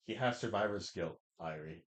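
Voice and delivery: low, soft excuse; mock sheepish Kiritsugu. Acted quote of Kiritsugu.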